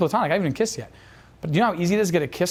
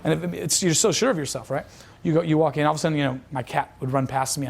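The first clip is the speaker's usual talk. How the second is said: ordinary talk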